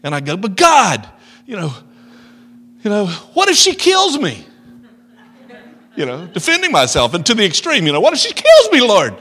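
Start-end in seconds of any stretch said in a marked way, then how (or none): 0.4-4.5 high, loud, panicky pleading; retelling a frightened plea to God; mock panicked plea. 8.0-9.1 high, loud, panicky pleading; retelling a frightened plea to God; mock panicked plea.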